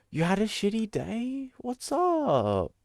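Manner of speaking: put-on high baby-talk voice. Talking to pet creature.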